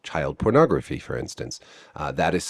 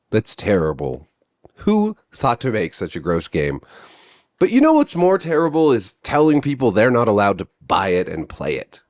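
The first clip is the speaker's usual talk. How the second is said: normal talking voice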